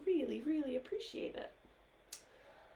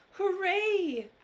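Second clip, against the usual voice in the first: cheer; 9 semitones higher, 10 dB louder than usual. Acted. Cheer in read-aloud book.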